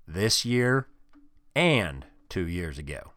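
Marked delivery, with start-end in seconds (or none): none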